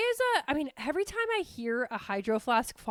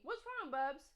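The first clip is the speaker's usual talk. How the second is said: tender pet talk to Bubs; soft pet talk; 2 semitones higher, 13 dB quieter than usual. Real, not acted. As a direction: coo gently at the pet. Talking to her pet.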